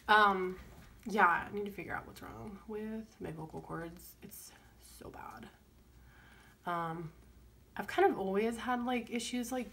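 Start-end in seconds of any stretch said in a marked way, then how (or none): 4.2-5.5 soft whispered complaint. Real, not acted. Vlogger grumbling to viewers about her failing voice, whispering. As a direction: whisper it under your breath.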